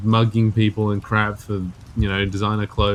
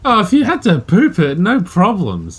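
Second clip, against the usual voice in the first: amused emphatic; 7 semitones higher, 3 dB louder than usual. Acted. Joking exaggeration.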